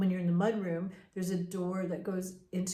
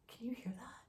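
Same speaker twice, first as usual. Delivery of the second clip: hushed, a little higher, nervous; scared whisper; 4 semitones higher, 12 dB quieter than usual. Retold or imitated. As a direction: whisper it to your brother in the dark. Scared brother in the dark.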